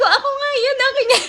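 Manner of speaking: high, giggly triumph; excited claim. Retold or imitated. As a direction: claim the prize laughing with joy. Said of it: "Sabi ko". (masayang-masayang pag-angkin)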